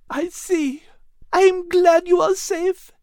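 very high, tearful French-tinged delight; overjoyed reunion, close to crying; high teary cry of happiness; joyful Liz. Acted. Liz relieved.

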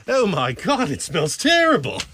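hammed-up British disgust; British accent. Mock British complaining.

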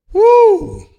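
high loud whoop of joy; excited whoop. Real, not acted. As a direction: let out a joyful woo.

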